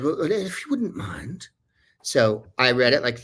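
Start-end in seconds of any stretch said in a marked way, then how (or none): 0.0-1.5 put-on higher, soft and polite English accent; calm English lady. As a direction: ask gently and politely, English-style. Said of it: "in her very calm English way".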